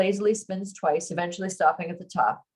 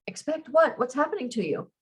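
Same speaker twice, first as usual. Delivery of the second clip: alarmed; 7 semitones higher than usual. Acted. Alarmed at her sudden attack.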